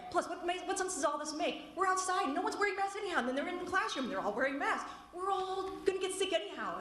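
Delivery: high, strained angry complaint; shrill anger. Real, not acted. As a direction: pitch rising in outrage. Angry parent at school board.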